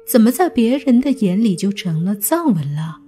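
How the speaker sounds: ordinary delivery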